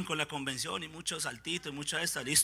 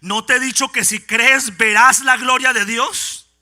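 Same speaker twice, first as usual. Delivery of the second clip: reproche indignado; 4 semitones higher, 13 dB louder, slower than usual. Acted. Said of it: "El Señor se indigna con Marta".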